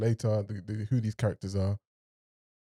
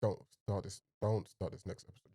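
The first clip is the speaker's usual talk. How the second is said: soft, low begging; pleading under breath; quiet plea; 4 semitones lower, 11 dB quieter than usual. Real, not acted. Begging the show not to start the next episode, softly.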